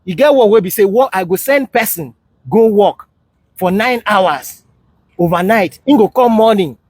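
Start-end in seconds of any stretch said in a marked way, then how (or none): none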